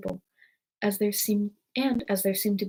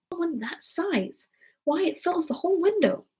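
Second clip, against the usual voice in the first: higher-pitched amazed character voice; astonished rabbit; 8 semitones higher than usual. A narrator's reading. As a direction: exclaim at the sheer size of it.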